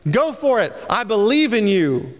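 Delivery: high, bright cheering on; a friend cheering you on; encouraging friend. Retold or imitated. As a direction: lift them up with belief. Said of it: "pat us on the back and say".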